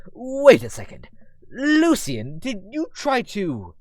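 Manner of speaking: high, loud, drawn-out disbelief; incredulous confrontation. Acted. Dad realising what Lucian attempted, loud incredulous questioning. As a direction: question the culprit in shocked disbelief.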